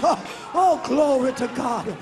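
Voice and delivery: ordinary talk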